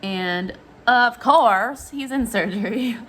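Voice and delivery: normal talking voice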